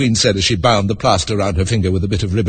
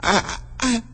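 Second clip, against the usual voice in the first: put-on high, puzzled girl's voice; Alice, lost and confused; lightly voiced puzzled girl; young Alice; 13 semitones higher, 3 dB quieter than usual. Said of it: "said Alice".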